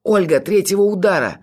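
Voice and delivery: high, strained desperate call; hoarse cry. A narrator's reading. Narrator struggling to rise warns Olga, strained cry. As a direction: force out a strained warning. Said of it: "прохрипел я".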